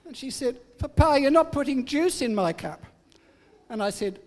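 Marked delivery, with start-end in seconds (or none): 0.8-2.8 put-on higher, louder put-out little girl; indignant child. Granddaughter complaining to her grandpa, higher and louder. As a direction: protest like a small child who's been wronged.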